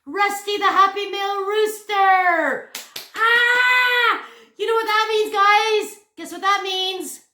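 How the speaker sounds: high, loud, squealing excitement; excited announcement. Real, not acted. Vlogger presents the Happy Mail rooster, excited shouting. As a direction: introduce the guest at full excited volume.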